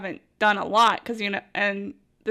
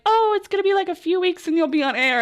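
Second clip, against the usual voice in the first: breezy quote; 8 semitones higher than usual. Retold or imitated. Quoting staff promise.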